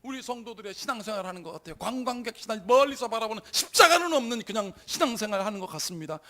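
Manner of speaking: loud, high outcry easing, then surging back; re-enacted lamenting prayer. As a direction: plead with God in a retold anguished prayer. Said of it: "막 탄식하면서 막 그냥 소리 지르면서".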